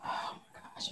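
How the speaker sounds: breathless, unvoiced groan; exasperated whisper. Real, not acted. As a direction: sigh it out voicelessly. Exasperated aside.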